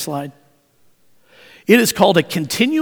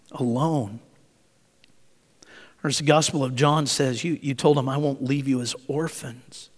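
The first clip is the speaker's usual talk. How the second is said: soft, hushed prayer with long pauses; 4 semitones lower, 7 dB quieter than usual. Real, not acted. Preacher praying to the Father with the congregation, hushed prayer. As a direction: pray softly and unhurriedly, leaving space between phrases.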